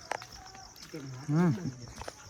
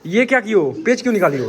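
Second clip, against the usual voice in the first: higher, louder angry yelling; angry shouting; 7 semitones higher, 13 dB louder than usual. Real, not acted. Uncle yelling at niece to stop watching TV.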